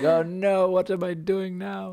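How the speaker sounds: hammed-up African accent, pitched high. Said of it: "That's my best African accent".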